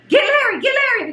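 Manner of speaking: retold urgent order. Mom telling Anthony to get Larry, retold urgent call. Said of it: "I told Anthony".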